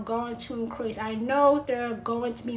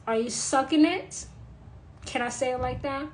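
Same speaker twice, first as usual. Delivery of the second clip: ordinary delivery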